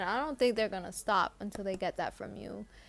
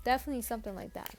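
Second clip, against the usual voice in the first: ordinary talk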